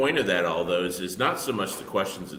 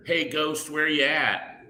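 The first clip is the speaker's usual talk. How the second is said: higher-pitched mock call to a spirit; lightly hammed-up ghost hunter; 6 semitones higher, slower than usual. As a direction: casually holler for a ghost, teasing. Mocking ghost hunters calling out.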